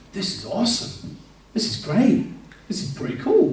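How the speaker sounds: high, rising retold wonder; impressed delight. Newcomer walking into a lively church, impressed delight. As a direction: act out being pleasantly amazed.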